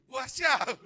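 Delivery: high, very loud exclamation; eager agreement. Retold or imitated. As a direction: agree instantly in a comic outburst. Acting his relieved ready agreement. (menyanggupi dengan seruan lantang)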